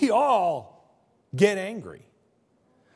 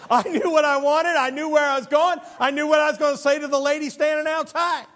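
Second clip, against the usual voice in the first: higher and louder, building to a shout; exasperated rant; 8 semitones higher, 5 dB louder than usual. Real, not acted. Ranting about slow McDonald's customers.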